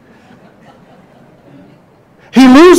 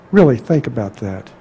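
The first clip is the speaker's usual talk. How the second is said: soft appeal; 9 semitones lower, 7 dB quieter than usual. Real, not acted. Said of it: "repeated appeal, quieter".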